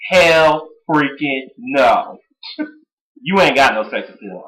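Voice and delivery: ordinary talk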